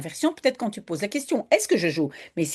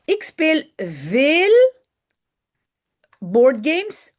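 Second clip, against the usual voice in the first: slow reading aloud; 5 semitones higher, 5 dB louder, much slower than usual. Shown as a demonstration. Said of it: "reading student sentence slowly".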